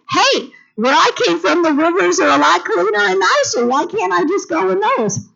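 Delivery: high, fast, huffy protest; indignant Naaman. Retold or imitated. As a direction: whine like a proud man offended. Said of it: "Let me justify why I am so mad".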